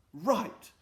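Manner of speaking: Friday's high eager announcement; bright eager. Acted. Friday to the crowd, eagerly high. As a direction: pitch it up, full of hope.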